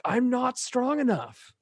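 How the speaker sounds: imitating Mister Incredible screaming at his wife; mock scream. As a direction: cry it out as a breaking confession. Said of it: "like, screams".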